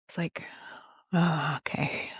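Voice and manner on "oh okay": teary yielding. Real, not acted. Tearful surrender to God.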